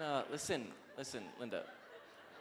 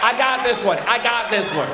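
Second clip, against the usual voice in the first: eager volunteer; 7 semitones higher, 18 dB louder than usual. Retold or imitated. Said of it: "You're like".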